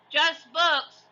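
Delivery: high, loud crewman shout. A narrator's reading. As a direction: call it down. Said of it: "shouted Stinky".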